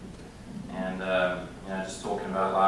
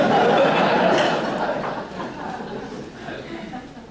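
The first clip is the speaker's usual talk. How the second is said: hearty laugh; 8 dB louder than usual. Real, not acted. Laughs after accent story.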